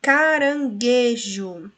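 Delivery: slow syllable demo. Shown as a demonstration. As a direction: draw out Caranguejo for the class. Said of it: "o G e o U é dígrafo".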